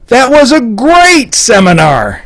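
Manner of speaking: high, slow, emphatic rave; over-the-top enthusiastic praise; gushing inflection. Shown as a demonstration. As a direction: rave about it, stretching "great" high. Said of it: "but the inflection".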